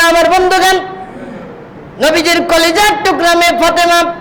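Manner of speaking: high-pitched sermon delivery; impassioned preaching. Acted. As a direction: preach it high and urgent, lines held up at the top.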